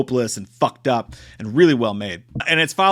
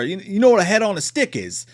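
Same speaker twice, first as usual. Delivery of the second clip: higher-pitched, badly faked Australian accent; 6 semitones higher than usual. Killer taunting the girl, badly faked Australian accent. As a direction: put on a poor Australian accent. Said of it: "I'm a terrible accent or doer guy".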